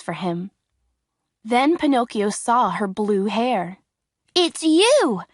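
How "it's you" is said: higher, eager cry of recognition; excited cry. A narrator's reading. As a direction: burst out, thrilled to see her. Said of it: "he cried".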